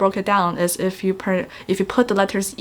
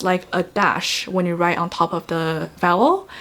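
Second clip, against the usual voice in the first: ordinary talk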